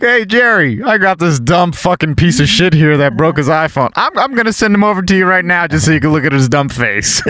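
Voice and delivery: high, fast mocking clerk voice; mock phone call. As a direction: ham up the rude coworker.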